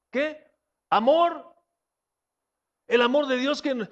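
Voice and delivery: ordinary talk, nothing marked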